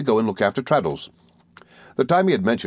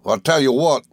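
gruff milkman; 6 semitones higher, 4 dB louder than usual. A narrator's reading. Milkman threatens servant.

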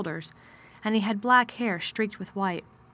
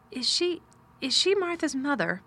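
mild hesitant stammer, pitched higher; 7 semitones higher than usual. A narrator's reading. Girl gathering courage to ask her guardian, timid stammer. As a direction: falter and restart in a small child's voice. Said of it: "she stammered".

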